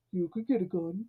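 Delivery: soft, low-key put-on reply; deep-voiced conscience. Myron's conscience talks him up to face gangsters, mock deep voice. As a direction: coolly counter in a muted character voice. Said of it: "it's this deep, deep voice".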